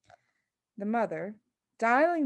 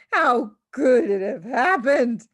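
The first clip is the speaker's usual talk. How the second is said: slow, louder wailing yell; 3 semitones higher, 8 dB louder, slower than usual. A narrator's reading. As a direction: wail in despair. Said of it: "he yelled".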